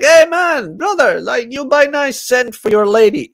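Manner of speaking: high, loud hawker pitch; pushy street salesman. Retold or imitated. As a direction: call out like a bazaar seller. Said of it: "He's like".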